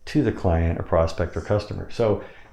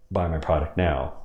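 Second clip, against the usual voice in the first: much lower and softer; lowered hidden command; lower pitch; 5 semitones lower, 4 dB quieter than usual. Shown as a demonstration. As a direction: sink the command down low. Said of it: "I lower my voice when I say those words".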